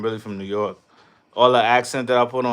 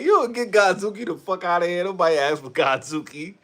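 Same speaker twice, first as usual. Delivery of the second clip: higher, put-on dismissive voice, lightly done; mock fed-up fan; 6 semitones higher than usual. Streamer imitating a fed-up fan, mock dismissive. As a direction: shoo him off in a put-on voice. Mocking a fan shooing Godzuki.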